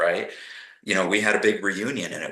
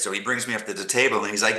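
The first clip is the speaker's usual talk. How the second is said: ordinary talk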